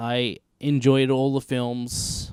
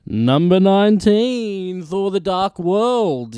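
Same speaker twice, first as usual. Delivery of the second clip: slow, higher, louder countdown announce; announcer voice; 6 semitones higher, 8 dB louder, slower than usual. Acted. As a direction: call it like a trailer host.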